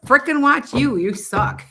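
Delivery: high, loud mock-exasperated heckle; hammed-up jeering; mock heckling viewer. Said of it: "you're like".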